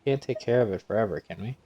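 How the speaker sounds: normal talking voice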